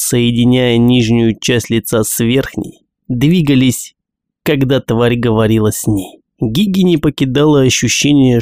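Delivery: ordinary delivery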